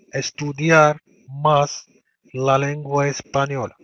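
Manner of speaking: very slow, careful model phrase; slow teacher dictation. Shown as a demonstration. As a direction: stretch it out for learners. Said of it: "teacher models sentence slowly".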